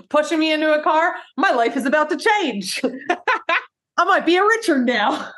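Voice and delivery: high, awestruck put-on voice; mock-amazed, rising excitement; Richard impression. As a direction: marvel at sudden good luck. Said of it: "he's like".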